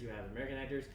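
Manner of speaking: ordinary talk, nothing marked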